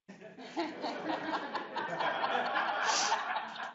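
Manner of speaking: a soft airy laugh; breathy laugh. Real, not acted. Speaker laughing at the word humor, airy laugh. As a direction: laugh quietly to yourself, no voice.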